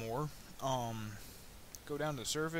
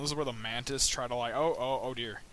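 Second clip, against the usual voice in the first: normal talking voice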